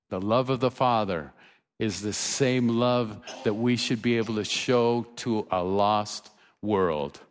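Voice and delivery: ordinary delivery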